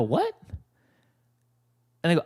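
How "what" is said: sharp high disbelieving what; a startled yelp of what; stunned what. Retold or imitated. Told his friend won't talk work, shocked high what. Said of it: "I go".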